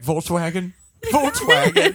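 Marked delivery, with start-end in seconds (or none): 1.1-1.9 high loud anguished cry; wailing. Pretend. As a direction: wail the name in grief. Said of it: "I'll be like".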